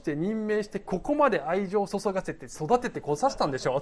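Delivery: high, loud mock accusation; playful outburst at God; acted indignant protest. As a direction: pile up the grievances, punching the first words hard. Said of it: "納得いかなかったんじゃないかなと".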